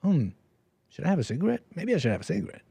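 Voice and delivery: soft, musing self-question; idle craving mulled over softly; mock inner musing. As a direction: quietly weigh the idea. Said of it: "Before it was like".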